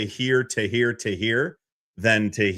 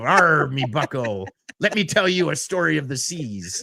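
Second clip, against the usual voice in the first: pirate voice; 3 semitones higher than usual. Pretend. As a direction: spin a yarn like a pirate captain. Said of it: "Am I a pirate?".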